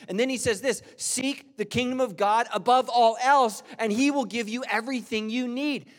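loud, high, fervent preaching; full-force preaching. Acted. Pastor urges the congregation to seek God, loud and high.

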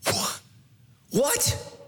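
stunned disbelief. Real, not acted. Astonished reaction.

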